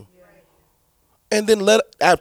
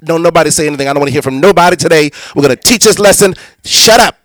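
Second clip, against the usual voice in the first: a hint of a stern, loud teacher barking at kids; louder, scolding the class; bitter teacher voice; 8 dB louder than usual. Pastor imitating a soured friend scolding children. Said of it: "She's gonna come in and say".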